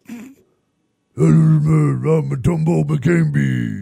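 a loud, put-on sloppy monster voice; goofy drunken monster impression; drunken Cookie Monster. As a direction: slur it like a tipsy Cookie Monster. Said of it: "like a drunken Cookie Monster".

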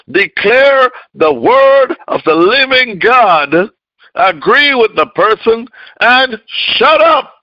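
high, loud preaching cry; preaching at full force. Acted.

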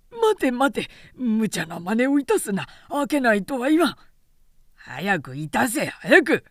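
high, loud frantic begging; flustered guard cry; panicked pleading. Acted. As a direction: beg them to stop. Terrified guard begs.

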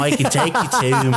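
high, hammed-up Australian girl, half laughing; Australian little girl. Co-host echoes the film's little girl, mock Australian accent. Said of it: "little tiny girl with an Australian accent".

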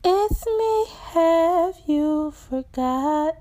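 very high, slow, drawn-out plea; crying out. Acted. Forgotten visitor begging to be remembered, high slow cry. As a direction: let the plea float high and long. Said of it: "She cries out".

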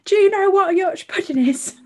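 high, loud mock cockney; cockney urchin asking loudly; Oliver-style cockney accent. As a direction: imitate your own question in hammed-up cockney. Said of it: "Did you say it in that accent".

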